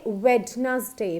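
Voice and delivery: WEDNESDAY with the d sounded, "WED-nuz-day"; common mistake. Shown as a demonstration. Teacher shows what we usually say, Wednesday as "WED-nuz-day". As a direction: say Wednesday in three syllables, d included. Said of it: "but it is not correct".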